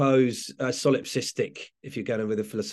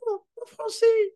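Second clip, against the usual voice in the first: put-on much higher, a small child's delighted cry; tiny voice at the back; 4 dB louder than usual. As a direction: imitate a little kid thrilled to hear French. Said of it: "I heard a little voice at the back go".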